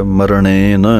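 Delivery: ਮਰਣੇਨ with ਣ first, then ਨ; pronunciation demo. Shown as a demonstration. Teacher showing students the right order of sounds in ਮਰਣੇਨ. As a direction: say ਮਰਣੇਨ as mar-ṇen, the retroflex ṇ clear. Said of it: "ਅਣਾਣਾ ਪਹਿਲਾਂ ਬੋਲਣਾ ਹੈ".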